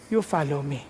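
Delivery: low, softened, final answer; God's calm reply. Acted. God answering a reluctant preacher, low quiet command. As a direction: drop low and quietly close the argument.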